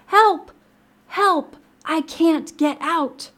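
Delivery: high, loud cries for help; panicked frog. Acted. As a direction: cry out as a trapped storybook frog. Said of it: "croaked Frog".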